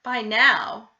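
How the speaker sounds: usual speaking voice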